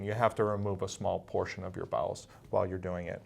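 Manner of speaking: normal talking voice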